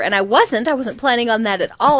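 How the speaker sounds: ordinary delivery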